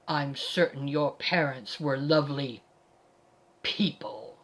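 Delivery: low, cold mock courtesy; icy pause before the final word; unfriendly compliment. A narrator's reading. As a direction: say the nice words with no friendliness. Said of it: "there was nothing friendly about it".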